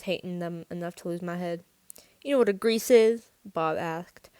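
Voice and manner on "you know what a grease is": loud, raised-pitch taunting question. A narrator's reading. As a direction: goad them loudly. Bully insulting the greasers.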